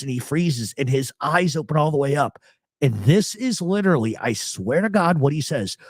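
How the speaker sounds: ordinary talk, nothing marked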